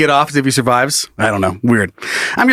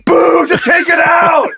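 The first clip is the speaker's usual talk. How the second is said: heckling crowd yell; 13 semitones higher, slower than usual. Pretend. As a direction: jeer at the surgeon from the stands. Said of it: "people are like".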